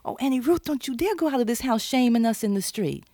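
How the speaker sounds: shocked sisters hold her back in alarm; alarmed scolding sisters. Retold or imitated. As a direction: sisters begging her not to shame them. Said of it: "They go".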